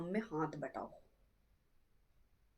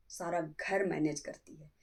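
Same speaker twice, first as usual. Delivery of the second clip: usual speaking voice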